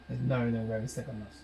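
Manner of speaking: quick mumble. Shown as a demonstration. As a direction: rattle it off under the breath. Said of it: "I am British".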